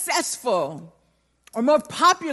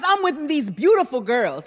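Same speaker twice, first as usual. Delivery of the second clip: normal talking voice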